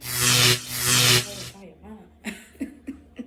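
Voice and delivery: low slow drawn-out laughing stress; laughing emphasis. Real, not acted. How she changed her mind, slow laughing emphasis. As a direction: stretch it out and laugh.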